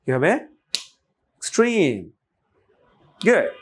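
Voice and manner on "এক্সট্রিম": "এক্সট্রিম" with high, slow, falling "trim"; barely touch "x", draw out "trim" high; second-syllable stress. Shown as a demonstration. Teacher repeats after asking learners, second-syllable stress. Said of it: "স্ট্রেসটা হচ্ছে পরের অংশে".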